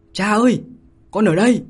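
put-on high, loud girlish cry; fake girl's voice. Falling, he fakes A Vân's cry to her father, shouting high. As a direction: shout like a young girl calling her father. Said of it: "gã giả giọng con gái".

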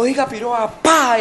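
high, loud demand building to a punch noise; retold threat. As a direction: act out a threat, exploding on the blow. Imita amenaza y golpe. (amenaza imitada, estalla en el golpe)

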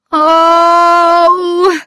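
long, high, loud moan; anguished cry. A narrator's reading. Sick, unhappy man on the bed, crying out. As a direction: cry out in misery, held long. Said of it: "cried the unhappy man".

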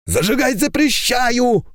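high forbidding royal shout; king's shout. A narrator's reading. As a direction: forbid it with full authority. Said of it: "второй — голос короля". (королевский окрик)

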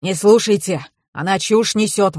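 ordinary delivery, nothing marked

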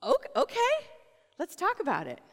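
a lightly voiced, high, amused God; God's reply. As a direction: play God, gently humoring David.

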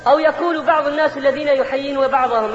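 normal talking voice

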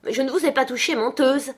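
high, indignant denial; angry cry. A narrator's reading. Girl denies pinching the maid, crying out. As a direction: throw the accusation back. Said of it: "cria-t-elle".

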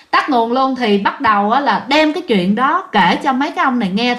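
plain reading voice

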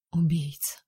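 soft, stunned repeat; stunned echo. A narrator's reading. Heroine echoing the word in shock, softly. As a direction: let the word sink in quietly.

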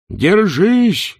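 high, loud shout. A narrator's reading. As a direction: yell over the howling wind. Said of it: "крикнул Ринсвинд".

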